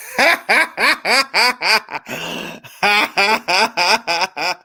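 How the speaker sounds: long hearty laugh. Real, not acted. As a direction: burst out laughing. Laughing at Cowboys losing.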